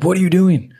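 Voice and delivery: high, loud alarmed cry; a startled outcry to stop him; shocked what are you doing. Retold or imitated. Girlfriend stopping him from killing a spider, alarmed shout.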